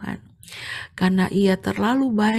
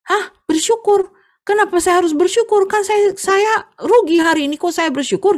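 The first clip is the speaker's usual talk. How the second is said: much higher, louder incredulous protest; indignant disbelief; 10 semitones higher, 6 dB louder than usual. Acted. Tofu seller balking at being told to be thankful, incredulous.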